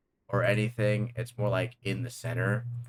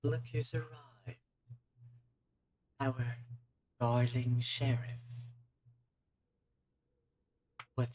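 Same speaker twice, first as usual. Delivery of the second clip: a purring, amused British-tinged demon, softer than the narration; sly villain voice; 4 semitones higher, 8 dB quieter than usual. Acted. Game master voicing the demon greeting the sheriff, silky and mocking. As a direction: savor every word like a smug villain.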